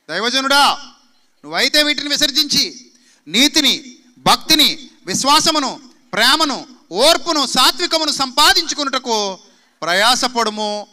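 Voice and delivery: fiery pulpit proclamation of scripture; preaching at full force. Acted. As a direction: thunder Paul's command at the church.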